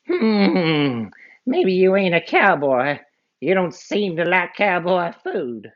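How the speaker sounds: high, musing cartoon bad guy; outlaw voice. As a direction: size him up suspiciously.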